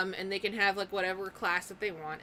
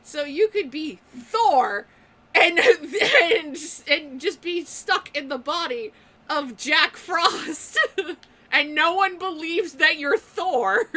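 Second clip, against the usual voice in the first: giggling through the words; laughing; 6 semitones higher, 9 dB louder, slower than usual. Real, not acted. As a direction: laugh while telling it. Laughing while describing possession.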